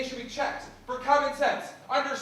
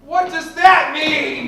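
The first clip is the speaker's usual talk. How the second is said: yelling; 3 semitones higher, 11 dB louder, much slower than usual. Acted. Couple yelling at each other.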